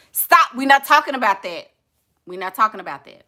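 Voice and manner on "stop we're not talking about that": higher, louder, cutting herself off; flustered stop. Real, not acted. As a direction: stop yourself mid-gush, loud.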